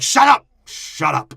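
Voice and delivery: high, loud hammed-up shout; mock angry yell. Podcast host voicing an angry character, loud mock yell. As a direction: snap it out like a furious old man. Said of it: "I'm gonna say it like this".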